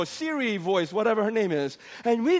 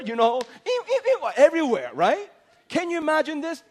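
ordinary talk, nothing marked

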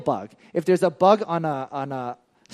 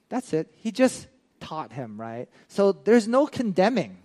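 ordinary talk, nothing marked

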